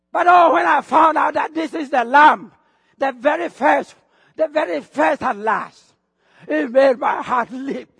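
loud, exultant burst of testimony; excited preaching. Real, not acted. Preacher testifying how he found the Lamb, excited. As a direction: proclaim it loudly, overcome with excitement. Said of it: "I get so excited".

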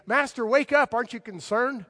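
a touch of mock panic; alarmed disciples. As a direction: shake him awake in alarm. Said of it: "and they say".

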